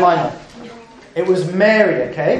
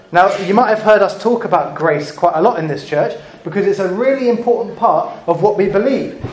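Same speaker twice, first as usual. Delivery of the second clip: ordinary delivery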